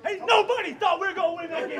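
loud, high excited yell; excited shout. Real, not acted. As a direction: yell it out, thrilled. Celebrating the win, shouting.

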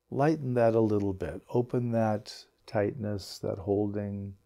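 unhurried meditation guide, long pauses between phrases; guided meditation. Acted. Teacher settling a group into belly breaths, calm guided meditation. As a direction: ease them gently into relaxing, leaving space after each instruction.

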